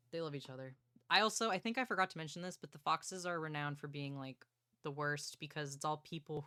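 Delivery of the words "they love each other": quiet, lower, dreamy repeat; soft sigh of adoration. Real, not acted. Fan repeating her favorite line softly after gushing. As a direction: say it softly to yourself, content.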